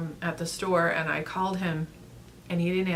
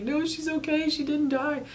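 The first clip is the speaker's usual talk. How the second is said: much higher, reassuring him fast; urgent reassurance; 9 semitones higher than usual. Retold or imitated. Telling husband the cat lived, pitched high and urgent. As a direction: blurt out that the cat is fine. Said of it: "And I was like".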